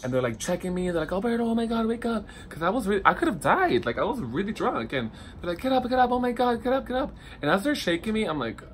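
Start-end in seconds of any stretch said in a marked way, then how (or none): 1.1-2.3 alarmed call. Retold or imitated. Friends finding him passed out. 5.6-7.1 much higher, louder, frantic "get up"; panicked urging. Retold or imitated. Friends frantically waking a passed-out Alberto, panicked higher urging.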